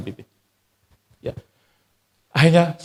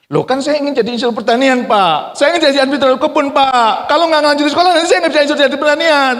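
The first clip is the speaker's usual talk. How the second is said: pleading son; 12 semitones higher, 6 dB louder than usual. Retold or imitated. Son begs father to study.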